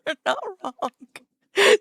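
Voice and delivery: slow, high, crying with laughter; laughing hard. Real, not acted. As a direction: squeeze the words out through laughter.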